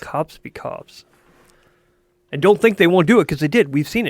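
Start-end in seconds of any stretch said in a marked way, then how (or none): 0.0-1.0 slow, whispered echo. Acted. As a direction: breathe it back slowly. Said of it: "repeated phrase".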